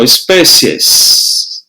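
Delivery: espécies said very slowly, E strong, I weak; teacher drawing out espécies slowly for the class; slow spelling of espécies. Shown as a demonstration. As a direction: stretch espécies out so the class hears the E. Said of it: "o som do E é mais forte do que o I".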